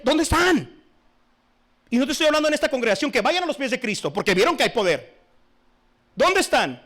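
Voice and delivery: loud, high fiery sermon; preaching at full force. Real, not acted. Preacher scolding the congregation, loud fiery preaching. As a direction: thunder it from the pulpit. Preacher demands to see converts.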